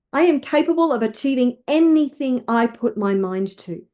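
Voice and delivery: emphatic, declarative affirmations; rousing self-belief, leading others to repeat; with conviction. Shown as a demonstration. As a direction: declare each one like you mean it. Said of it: "Say it with conviction".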